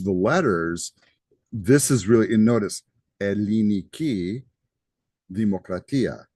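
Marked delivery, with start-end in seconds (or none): 3.2-6.3 Greek pronunciation. Shown as a demonstration. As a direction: say "Elliniki Demokratia" carefully as a Greek would. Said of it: "how you say it in Greek".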